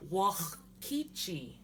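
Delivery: slow "wakichi" with a throaty rasp after "wa"; guttural X. Shown as a demonstration. Teacher giving the word for above, "wakichi" with guttural X after "wa". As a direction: say "wakichi" slowly, scraping the throat before "ki". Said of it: "So there's that X sound".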